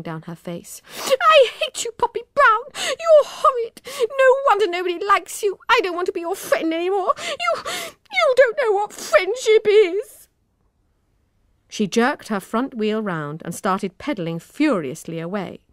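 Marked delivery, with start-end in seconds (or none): 1.0-10.3 high, loud tearful outburst; tearful cry. A narrator's reading. Emma crying out at Poppy in a tearful high scream. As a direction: scream it through tears. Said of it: "Emma cried, tears streaming".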